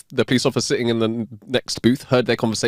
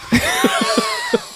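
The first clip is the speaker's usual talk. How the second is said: high wheezing laugh. Real, not acted. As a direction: burst into a squealing laugh. Big laugh after punchline.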